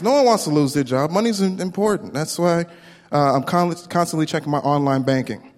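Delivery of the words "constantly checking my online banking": ordinary delivery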